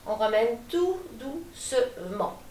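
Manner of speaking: slow, syllable by syllable; gently drawn out. Real, not acted. Coach easing the class back to centre, gently drawn out. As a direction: stretch each syllable to slow them down.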